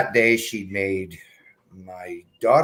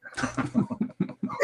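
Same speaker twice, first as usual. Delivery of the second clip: laughing; 7 dB quieter than usual. Real, not acted. Laughing while telling.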